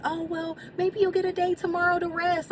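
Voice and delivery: high, dismissive mimic of staff; mocking tone. Mocking the staff who offered her a rest day. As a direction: mimic the brush-off, high and airy. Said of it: "it's like".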